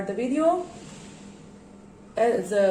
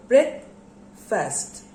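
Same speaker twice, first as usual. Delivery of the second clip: BREAKFAST split in two, with a long gap between break and fast; syllable by syllable; much slower than usual. Shown as a demonstration. Teacher counting syllables for pupils, broken into syllables. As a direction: say BREAKFAST as two separate beats. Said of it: "how many syllables are there".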